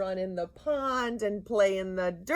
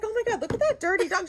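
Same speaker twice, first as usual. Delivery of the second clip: fast, high, louder mock astonishment; retold exclamation; 11 semitones higher, 5 dB louder, faster than usual. Friend pointing out a dirty dog, astonished. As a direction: rattle it off high and loud, as the startled friend.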